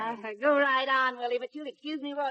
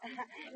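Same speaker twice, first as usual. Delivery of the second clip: soft laugh; 16 dB quieter than usual. Acted. Mother laughs at his joke.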